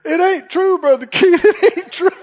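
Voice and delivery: put-on high, urgent pleading voice of a flustered young husband. Young husband pleading with the pastor, high and earnest. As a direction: protest your innocence with a nervous laugh. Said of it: "He said".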